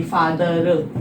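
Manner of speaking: drawn-out फादर with an audible R at the end; slowly over-pronounce it as "faa-dha-rr"; sounded final R. Shown as a demonstration. Teacher showing what not to read, final R sounded. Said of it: "इसको आप ऐसे नहीं पढ़ेंगे".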